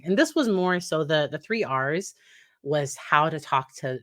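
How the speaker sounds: ordinary talk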